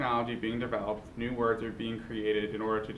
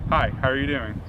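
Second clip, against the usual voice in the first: an easy American accent, louder; 7 dB louder than usual. Shown as a demonstration. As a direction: greet someone in an American accent. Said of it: "Here's an American accent".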